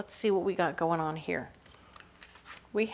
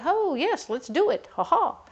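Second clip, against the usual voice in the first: bright, much higher, louder eager agreement; eager yes; 9 semitones higher, 6 dB louder than usual. Retold or imitated. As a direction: chirp it like a delighted friend. Friends eagerly agreeing to host.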